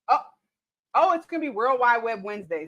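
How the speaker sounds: ordinary talk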